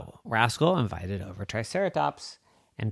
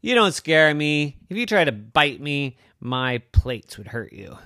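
lightly voiced brave stegosaurus; 5 semitones higher, 5 dB louder than usual. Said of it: "And the Stegosaurus says".

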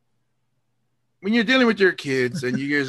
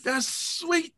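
high, slow gloating "that's sweet"; quiet cheer; 13 semitones higher, 3 dB quieter, much slower than usual. Retold or imitated. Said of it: "now I'm cheering".

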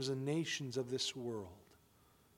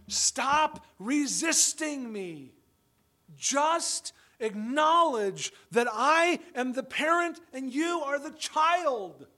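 much higher, louder, very slow stern scolding; exasperated father; 8 semitones higher, 8 dB louder, slower than usual. Retold or imitated. Father scolding his defiant children, high, loud and slow. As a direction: lay down the law to a defiant child. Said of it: "I sometimes want to say to them".

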